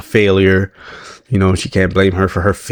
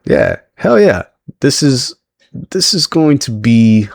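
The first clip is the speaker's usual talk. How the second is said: excited cheer; 5 semitones higher, slower than usual. Retold or imitated. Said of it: "And I'm just like".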